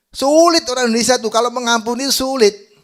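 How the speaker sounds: high, loud pulpit outcry; shouted preacher's complaint; emphatic exclamation. Real, not acted. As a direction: drive the point home at full volume.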